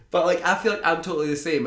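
ordinary talk

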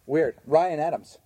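ordinary delivery

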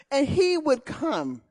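ordinary delivery

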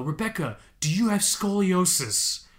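mock the fumbling anesthetist's question; hammed-up clueless medic; imitating the anesthetist. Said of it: "the anesthetist just keeps saying".

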